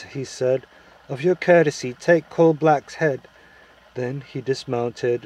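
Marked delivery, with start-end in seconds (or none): 1.1-3.3 higher, louder courteous command; lightly voiced prince giving an order; courteous princely order. A narrator's reading.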